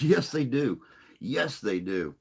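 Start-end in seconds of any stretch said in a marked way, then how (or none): 0.0-0.8 says it through a laugh, pitched up and louder; amused, laughing agreement. Real, not acted. Laughing at Groundskeeper Willie comparison.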